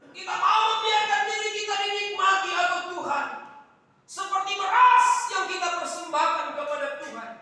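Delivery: high, loud preaching at full force; loud, high-pitched sermon delivery; fiery preaching. Acted. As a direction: preach it out to the whole church.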